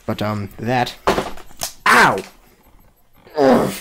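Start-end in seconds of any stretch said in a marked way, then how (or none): 1.8-3.7 sharp yelp of pain; pained groan after a stubbed toe. Real, not acted. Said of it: "I just stubbed my toe".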